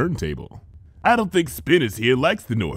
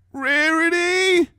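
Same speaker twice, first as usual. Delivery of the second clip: drawn-out high call from another room; floating in from afar; much slower than usual. Acted. Little sister calls from the guest room, faint drawn-out call. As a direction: call slowly from down the hall. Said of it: "voice floated in from the guest room".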